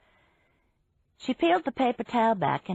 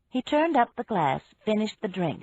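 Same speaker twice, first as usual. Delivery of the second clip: ordinary delivery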